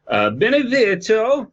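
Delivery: high, slow Benevito with an exaggerated European lilt; hammed-up Benevito; mock pronunciation. Shown as a demonstration. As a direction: say Benevito slowly in a hammed-up foreign accent. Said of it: "weird European to say names".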